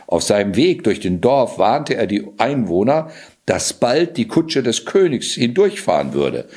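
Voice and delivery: plain reading voice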